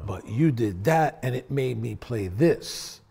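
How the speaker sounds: slow, deliberate teacher's breakdown; slow explaining. Retold or imitated. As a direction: spell it out slowly to the student. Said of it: "He goes".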